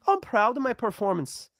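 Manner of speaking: a lightly done, bad Irish accent; mock the fighter in a bad Irish accent. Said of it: "that's a bad Irish accent".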